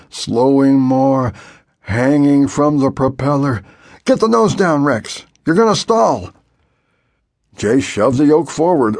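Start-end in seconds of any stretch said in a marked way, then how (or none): 4.1-6.3 urgent warning. A narrator's reading.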